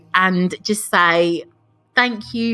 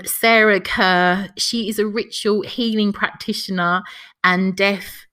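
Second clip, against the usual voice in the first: ordinary talk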